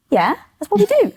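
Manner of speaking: high, breezy of-course answer; mock matter-of-fact; obvious reply. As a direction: shrug it off as obvious. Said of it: "I'm like".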